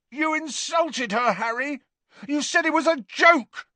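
very high, indignant shout. A narrator's reading. Dangling Ron defends his beloved to Harry, shouting. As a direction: cry out in strained, high-pitched outrage. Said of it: "shouted Ron".

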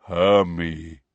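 low rumbling giant voice; deep rumbling voice. Acted. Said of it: "said in a deep, rumbling voice".

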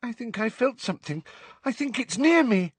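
high, fearful schoolgirl; shivering girl, pitched very high; frightened. A narrator's reading. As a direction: voice climbing in nervous dread. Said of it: "who shivered and pressed herself closer".

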